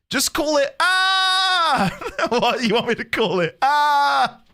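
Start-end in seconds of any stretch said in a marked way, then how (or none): 0.8-2.0 sudden high shriek of a name; playful high shriek; mock scream. Naming the unit a scream. 3.6-4.4 mock scream. Repeating the scream name.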